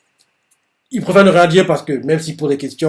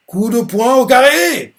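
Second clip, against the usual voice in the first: higher, louder and slow, like an attack name; called-out attack; 9 semitones higher, 6 dB louder, slower than usual. Retold or imitated. Mash calling out his squared punch attack, shouted slowly. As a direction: announce the punch like an anime hero.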